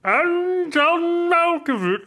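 high, slow Malkovich with rolled r. Retold or imitated. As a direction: pitch up high and drag it. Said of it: "roll those R's".

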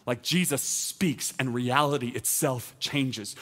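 ordinary talk

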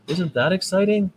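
a bright burst of real excitement; excited. Real, not acted. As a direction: pitch jumps way up on exciting. Cheering a court ruling.

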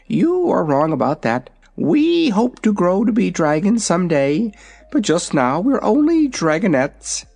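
high, proud little dragon; young dragon voice. Acted. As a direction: talk like a vain baby dragon. Dragonette speaks.

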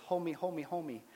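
lightly imitated small child begging; Maggie, pleading toddler. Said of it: "like Maggie, my two-year-old".

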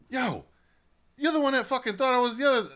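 high, loud retold accusation; high-pitched "yo" call-out impression; mock call-out. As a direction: pitch it way up, calling someone out. Retold teammate calling out a mix-up.

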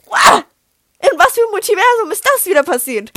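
high, loud incredulous outburst; astonished, exclaimed question; loud disbelief. Real, not acted. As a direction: cry out in total disbelief. Reacting in disbelief to the reply.

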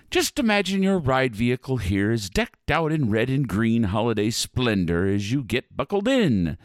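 ordinary delivery

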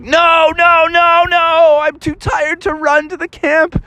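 high, whining plea; whining Bubby. Acted. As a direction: whine high about being too tired. Bubby whines too tired.

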